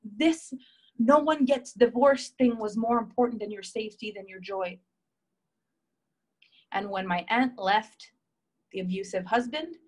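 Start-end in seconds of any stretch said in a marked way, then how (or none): 0.0-4.8 higher, louder, indignant questioning; livid grandma. Retold or imitated. Grandma berating aunt for putting rules over safety, indignant. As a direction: demand answers like a livid grandmother. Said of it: "My grandma was livid".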